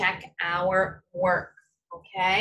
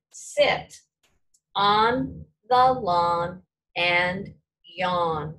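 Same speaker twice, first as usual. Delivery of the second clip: slow dictation; 3 semitones lower, 3 dB louder, much slower than usual. Shown as a demonstration. Dictating a sentence to class.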